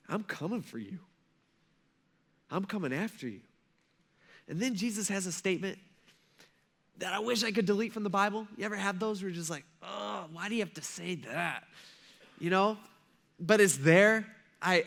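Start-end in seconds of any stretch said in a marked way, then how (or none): 0.1-3.4 soft, measured warning; quiet threat. Acted. God warning those who won't forgive, quiet threat. 9.8-11.6 soft groaning protest; mock complaint. Believer reacting to a hard verse, mock complaint.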